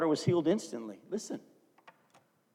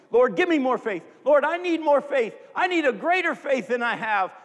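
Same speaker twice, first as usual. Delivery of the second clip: loud, higher, begging plea to the Lord; pleading prayer; 6 semitones higher, 6 dB louder than usual. Retold or imitated. Believer begging God for more faith, loud plea.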